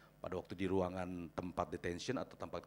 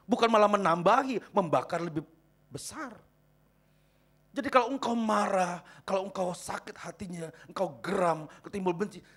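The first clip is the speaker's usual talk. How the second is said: raised, urgent sermon voice; full-force preaching; 12 semitones higher, 13 dB louder than usual. Real, not acted. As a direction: exhort the congregation loud and high.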